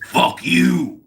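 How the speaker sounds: loud curt snap; mock rude retort. [name] retorting to a fan, loud curt snap. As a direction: bark it back rudely. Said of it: "And he says".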